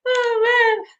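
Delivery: dreading being waxed, she shrieks with laughter; high laughing squeal. Real, not acted. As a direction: laugh up high then groan. Laughing at waxing threat.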